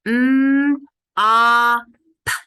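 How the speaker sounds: M, ah, p segmented loudly; sounding out. Shown as a demonstration. Teacher sounding out mop to the class, loud and segmented. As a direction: say M then a loud ah then p, each apart. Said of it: "Listen carefully".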